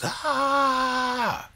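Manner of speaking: sudden high, loud shriek of fright; cabbie's panicked scream as the passenger taps his shoulder; startled scream. Acted. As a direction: shriek like someone grabbed you from behind. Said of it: "the driver screams".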